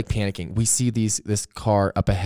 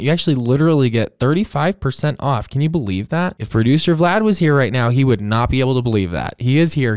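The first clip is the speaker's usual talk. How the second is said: upbeat, louder sponsor read; 4 semitones higher, 4 dB louder than usual. Acted. Host to listeners reading the sponsor spot, upbeat ad read. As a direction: sell it with a bright push.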